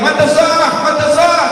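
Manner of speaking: high, loud calling out; villager yelling. Retold or imitated. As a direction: yell across the crowd for the Prophet. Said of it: "Dia teriak-teriak".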